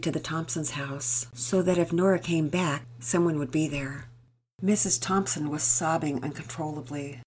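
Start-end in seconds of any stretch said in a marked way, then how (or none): none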